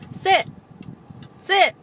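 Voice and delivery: loud, high barked "Sit!"; sharp dog command. Real, not acted.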